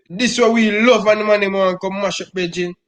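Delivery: ordinary talk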